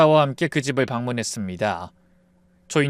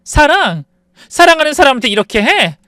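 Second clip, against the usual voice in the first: angry retort; 10 semitones higher, 9 dB louder than usual. Acted. Wife answers sister-in-law angrily.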